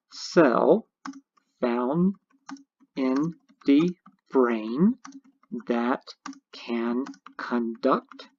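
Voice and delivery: slow, word by word with pauses, as if typing; slow dictation. Shown as a demonstration. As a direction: spell it out slowly while typing each word. Dictating answer while typing it.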